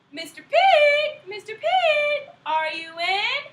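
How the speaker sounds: high, slow, loud sing-song call; wolf calling. Acted. Wolf sweetly calling the third pig through the door.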